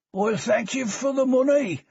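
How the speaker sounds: high, slurred Birmingham tramp voice; drunk Birmingham accent. Acted. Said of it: "in a Birmingham accent slurred by alcohol".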